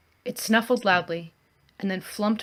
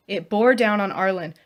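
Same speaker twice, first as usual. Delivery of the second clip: ordinary delivery, nothing marked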